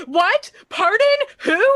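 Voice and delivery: high, loud imitated yelling into a phone; mock frantic shout. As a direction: ham up the screaming phone answer. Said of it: "screaming into the phone".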